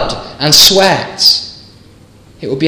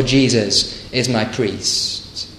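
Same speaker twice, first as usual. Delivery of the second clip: slow, softer and lower, "Jesus is my priest" let fall; lesser claim; 6 semitones lower, 8 dB quieter, slower than usual. Shown as a demonstration. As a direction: set it aside as the smaller claim.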